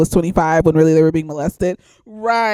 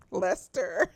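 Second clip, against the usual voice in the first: laughing; 2 semitones lower, 12 dB quieter than usual. Real, not acted. Laughs while naming him.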